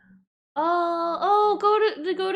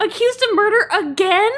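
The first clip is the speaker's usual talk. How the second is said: high, rising outraged question; high-pitched astonishment; incredulous; 10 semitones higher, 4 dB louder than usual. Real, not acted. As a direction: protest in disbelief. Reacts to murder accusation.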